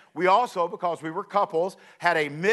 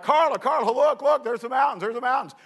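high, eager calling out to his wife; pointing out the mountains, bursting with excitement; excited; 6 semitones higher than usual. Retold or imitated. Groom on his honeymoon calling his wife to look, excited. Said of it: "And I'm getting excited".

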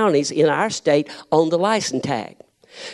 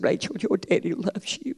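a softer, tender father's voice; 8 dB quieter than usual. Retold or imitated. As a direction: quietly tell your daughter you love her. Father moved, tears in eyes.

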